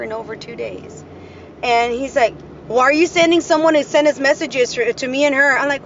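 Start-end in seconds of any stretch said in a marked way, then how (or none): 2.7-5.6 imitating his agitated complaint, higher and louder; retold uproar. Ex-husband calls to complain about messages, in an uproar. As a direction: fire off an ex's indignant question. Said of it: "he calls me in an uproar".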